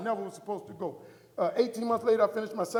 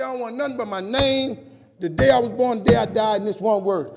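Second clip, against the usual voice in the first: loud declaration; 3 semitones higher, 14 dB louder than usual. Retold or imitated. Husband dictating his tombstone to wife, loud and emphatic. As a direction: proclaim each item at full volume.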